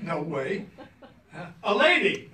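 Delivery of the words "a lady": high, loud reply across the store; called-out answer. Retold or imitated. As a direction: call it out to the clerk. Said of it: "I said".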